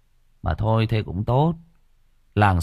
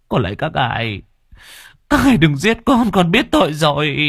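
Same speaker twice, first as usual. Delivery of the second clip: desperate begging; 6 semitones higher than usual. Retold or imitated. Mãnh kneels and begs unseen lords not to kill him. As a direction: beg on your knees for your life. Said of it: "van xin khẩn thiết".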